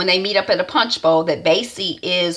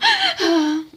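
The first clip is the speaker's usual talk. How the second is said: breathy sigh; 11 semitones higher than usual. Retold or imitated. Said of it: "she's all breathing hard".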